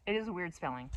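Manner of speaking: ordinary talk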